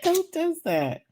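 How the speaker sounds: high-pitched, amused disbelief; retold high-voiced disbelief; incredulous reaction. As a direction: squeal it softly, as if scandalized. Retold reaction to shady insult.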